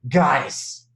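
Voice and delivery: Melody voice. Retold or imitated. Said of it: "in my best Melody voice".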